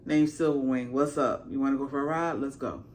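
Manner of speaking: accepting the rider casually; laid-back dragon voice; chill dragon. Retold or imitated. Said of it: "the dragon just like".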